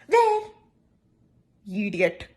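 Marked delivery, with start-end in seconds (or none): 0.1-0.4 high startled where again; exaggerated where. Comic retelling a friend's clumsy glance, high. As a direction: squeal it like a clueless friend. Repeat of mocked exclamation.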